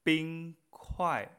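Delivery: Bing kuai, "Bing" high and level, "kuai" falling; primer y cuarto tono. Shown as a demonstration. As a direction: hold Bing high, then drop on kuai. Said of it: "primer tono". (Bing kuai con "Bing" alto y "kuai" descendente)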